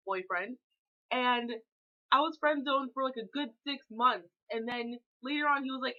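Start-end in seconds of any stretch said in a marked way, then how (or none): none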